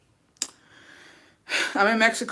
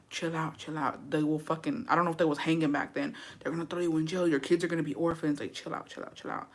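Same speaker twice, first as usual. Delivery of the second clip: much softer, fading to a whisper; quiet self-talk; 9 dB quieter than usual. Acted. Wife holding a knife talking herself down, fading to a whisper. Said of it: "I'm like".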